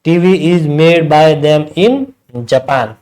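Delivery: very slow, word by word, like writing on the board; slow dictation. Shown as a demonstration. Teacher dictating the full answer to students, very slow. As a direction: spell the answer sentence out slowly for students. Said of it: "इस sentence को अगर पीछे अपन ऐसे लिखे".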